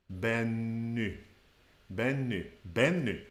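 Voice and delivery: Benny ending in a rounded u-like vowel, lips forward; Swedish Benny. Shown as a demonstration. Teacher contrasting with English, Swedish pronunciation. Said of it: "the stress is at the beginning".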